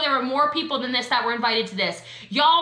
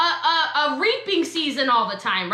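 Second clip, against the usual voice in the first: ordinary delivery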